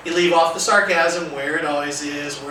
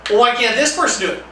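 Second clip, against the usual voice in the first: higher, louder defiant pushback; challenging student; 5 semitones higher, 4 dB louder than usual. Acted. Student challenging the teacher's instruction, louder and defiant. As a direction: push back to bait a power struggle.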